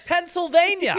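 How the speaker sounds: high, loud, laughing proclamation; proud laughing answer. Retold or imitated. Traveler proudly tells Italians his home state, loud laughing.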